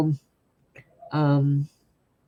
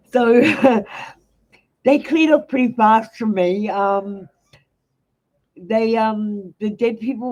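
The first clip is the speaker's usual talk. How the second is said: laughing; 6 semitones higher, 4 dB louder, slower than usual. Real, not acted. Laughing after the catch story.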